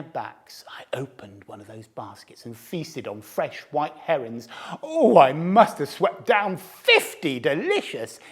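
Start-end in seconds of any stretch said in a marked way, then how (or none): 0.0-4.5 whispered, sneaking; secretive whisper. A narrator's reading. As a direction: whisper it like a thief at work. Sneaking while backs turned.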